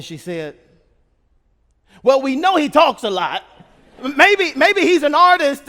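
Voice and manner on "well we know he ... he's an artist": higher, much louder, voiced as a mother; mother's musing verdict. Mother sizing up her talkative son, musing out loud. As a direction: pitch it up and push it out.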